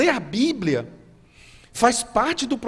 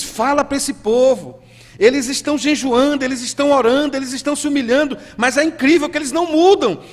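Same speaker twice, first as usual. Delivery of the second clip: loud, raised preaching voice; God's indignant command; 6 semitones higher, 7 dB louder than usual. Acted. God ordering the prophet to speak to this people, indignant command. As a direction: thunder it out, pitched high and mounting. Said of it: "Então ele diz".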